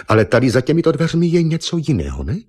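high, pointed questioning; ostré vyptávání. A narrator's reading. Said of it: "ostře pohledla na Argona". (vysokým hlasem, ostře a tázavě)

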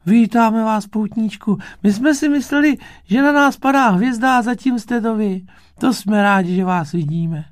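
very high, loud tiny-creature voices; delighted little bugs. A narrator's reading. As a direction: greet the visitor in a squeaky childlike voice. Bug family greets pilgrim happily.

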